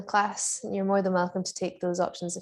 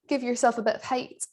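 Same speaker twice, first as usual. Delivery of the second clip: ordinary delivery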